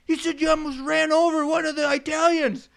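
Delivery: loud, high-pitched accusation; imitated angry foreman. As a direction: accuse him like an irate boss.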